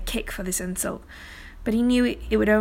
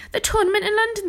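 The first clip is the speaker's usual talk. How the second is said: high-pitched eager outburst; urgent excitement; 12 semitones higher, 4 dB louder, faster than usual. A narrator's reading. Arthur explaining what he burst in about, urgent excitement.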